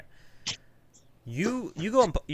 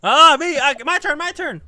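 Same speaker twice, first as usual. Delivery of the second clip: eager; 11 dB louder than usual. Pretend. Eager to join the beating.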